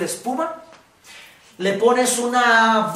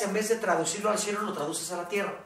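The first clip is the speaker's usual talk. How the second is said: ordinary talk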